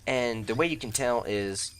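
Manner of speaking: normal talking voice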